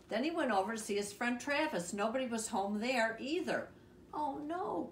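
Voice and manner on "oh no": high, drawn dismayed cry. A narrator's reading. Froggy finds Travis out, high cry of dismay. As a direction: lament it, pitched well up. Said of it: "cried Froggy".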